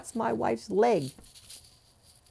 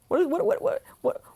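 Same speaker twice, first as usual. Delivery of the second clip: incredulous; 13 semitones higher than usual. Real, not acted. Mock disbelief at dad.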